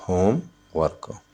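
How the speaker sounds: "Homework" as होम, pause, work; syllable by syllable. Shown as a demonstration. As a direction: break "homework" into two beats. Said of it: "तो इसमें टू syllable है". ("Homework" को होम... work, अलग-अलग)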